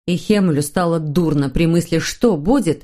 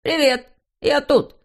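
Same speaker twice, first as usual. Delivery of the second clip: calling out; 7 semitones higher than usual. A narrator's reading. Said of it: "а потом крикнул".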